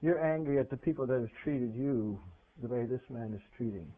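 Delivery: low, soft and tender, fading away; God gently explaining to the preacher why he is angry; gentle voice of God. Acted. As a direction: speak softly as God consoling him. God answers the crying preacher.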